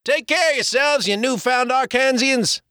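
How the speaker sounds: high call from the boat. Acted. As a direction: shout farewell across the river. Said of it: "cried George Duty".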